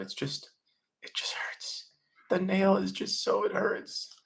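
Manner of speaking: high, breathy whimper of pain; whimpering whisper. Retold or imitated. Woman with nail in head, whimpering to boyfriend. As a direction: whimper it like a hurt child wanting sympathy.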